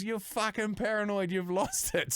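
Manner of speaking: mock crackhead jeer. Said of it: "He goes".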